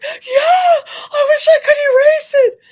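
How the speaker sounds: high, loud imitation of a sobbing boy; crying kid. Boy answering the school psychologist's guess, imitated loud crying. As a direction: wail the admission like a crying child. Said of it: "he was, like, crying".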